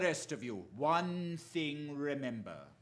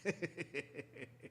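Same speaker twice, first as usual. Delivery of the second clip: villain's laugh; 8 dB quieter than usual. Acted. Villain gloats over Holmes's death.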